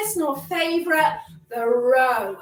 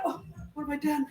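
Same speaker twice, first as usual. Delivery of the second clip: mock regret; 9 dB quieter, much faster than usual. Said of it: "Sometimes you're a bit like".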